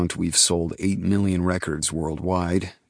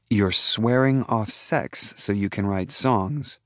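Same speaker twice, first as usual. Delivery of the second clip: slow, higher female voice; Kirby's voice; 5 semitones higher than usual. A narrator's reading. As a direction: give her a dry, slow delivery. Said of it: "voice is husky and soft".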